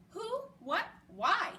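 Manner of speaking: startled, tripping over each word; stammering. A narrator's reading. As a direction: stumble out the questions in surprise. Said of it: "stammered Judy".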